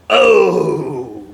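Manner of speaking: a sudden loud yelp of fright; startled cry. Pretend. Startled by the doll, a sudden loud cry. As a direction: jump at the scare and cry out.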